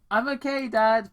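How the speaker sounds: calm her dad from cover; reassuring girl. Acted. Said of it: "Paige assured".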